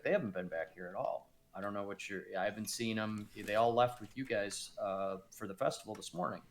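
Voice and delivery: low, quiet, barely moving lips; low covert mutter. Acted. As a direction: talk like a ventriloquist, hushed. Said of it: "he's like".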